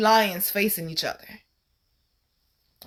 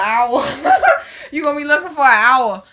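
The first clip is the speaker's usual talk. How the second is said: breaking into a laugh, then higher and louder; 5 semitones higher, 5 dB louder than usual. Real, not acted. Storyteller joking about begging a cigarette, laughing. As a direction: laugh through it and repeat it bigger. Laughing at her own remark.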